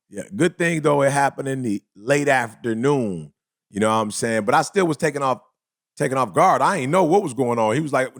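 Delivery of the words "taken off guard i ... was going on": normal talking voice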